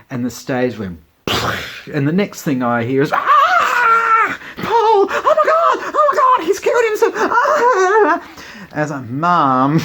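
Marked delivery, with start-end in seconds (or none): 3.1-8.3 shrieking very high and loud in horror; panicked scream. Retold or imitated. Mum shrieking in panic as the stage explodes. 9.1-9.8 exasperated mum. Retold or imitated. Scolding mum.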